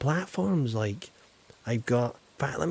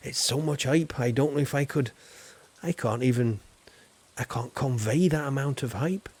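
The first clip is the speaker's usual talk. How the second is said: ordinary talk, nothing marked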